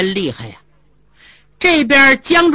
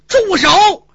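loud, high barked stop; sharp shout; 9 semitones higher, 5 dB louder than usual. Acted. Zhu Yuanzhang stopping the fight, a sharp loud shout. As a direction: cut the brawl short with one shout. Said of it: "断喝一声".

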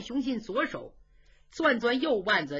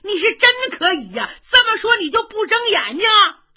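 much higher and louder, fed-up scolding; exasperated; 11 semitones higher, 10 dB louder than usual. Acted. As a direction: blow up at a stubborn brother. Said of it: "程咬金急了".

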